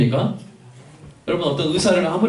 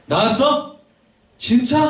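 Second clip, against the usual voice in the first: high, rising astonished questions; disbelieving joy, pitched up high; surprised father on phone; 8 semitones higher, slower than usual. Acted. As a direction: react to sudden good news. Father phoning, hears son recovered.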